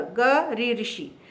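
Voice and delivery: ग री ऋषि spelled slowly, each part apart; slow spelling out. Shown as a demonstration. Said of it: "इसका उच्चारण भी". (ग री ऋषि को धीरे-धीरे अलग करके बोलना)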